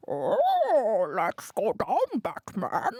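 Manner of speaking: slow, drawn-out Batman growl with swoops; hammed-up weird film-star voice. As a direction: stretch the vowels in a daft impression. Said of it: "that other guy with the weird voice".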